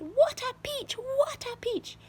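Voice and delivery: very high gleeful exclaiming; excited aunt. A narrator's reading. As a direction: gush over the peach. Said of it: "Aunt Spiker shouted".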